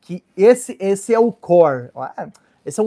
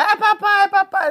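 put-on high whiny child's voice; child whining; 12 semitones higher than usual. Father imitating his child whining papai. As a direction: whine like a small kid tugging at dad. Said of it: "A criança vai".

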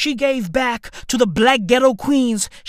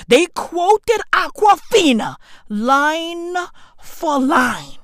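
slow mock shout; 5 semitones higher, slower than usual. Mock outrage.

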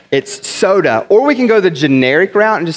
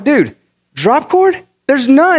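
high-pitched incredulous retort; retold exasperated protest; acted bafflement; 10 semitones higher, slower than usual. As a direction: draw out the question in disbelief. Acts out baffled exchange with coworker.

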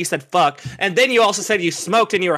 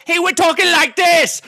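this line in a high, hammed-up mock British accent; 12 semitones higher, 4 dB louder than usual. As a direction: parody a coworker's fake British accent. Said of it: "trying to talk like a British guy".

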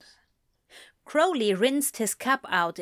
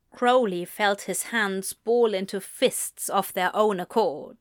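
ordinary delivery

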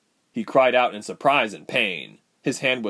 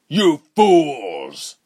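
loud, higher villain shout; furious scream; 6 semitones higher, 8 dB louder than usual. Acted. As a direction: scream furiously at useless underlings. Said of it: "Reuben screamed".

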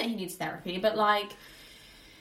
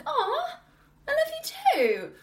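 much higher, gushing mother; sweet mum voice; 3 dB louder, slower than usual. Retold or imitated. Mum answering her son's I love you, cooing high. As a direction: coo it like a doting mum. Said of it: "And she's like".

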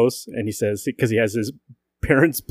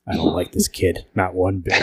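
Batman; 5 semitones lower than usual. Retold or imitated. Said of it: "Batman just thinks".

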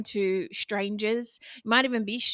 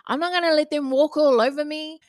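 higher, louder mock protest; defiant; 5 semitones higher, 5 dB louder than usual. Speaker voices listeners who won't be walked over, defiant. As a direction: push back as an indignant listener.

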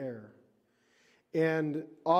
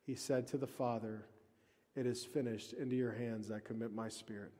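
soft, lowered retelling of dying words; hushed, solemn; 4 semitones lower, 10 dB quieter than usual. Retold or imitated. Preacher retelling Jesus' last words to the Father, hushed and solemn. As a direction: drop your voice right down, reverently.

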